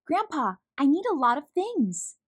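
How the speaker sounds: put-on excited child calling out to Grandpa. A narrator's reading. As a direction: call out eagerly across the yard. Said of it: "Tess calls out".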